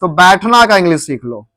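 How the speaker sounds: normal talking voice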